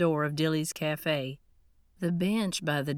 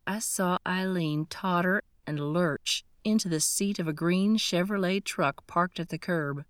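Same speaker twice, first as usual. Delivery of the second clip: usual speaking voice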